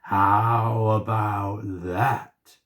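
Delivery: very slow, menacing monster. A narrator's reading. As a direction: draw it out right in her face. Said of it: "put one glowing eye".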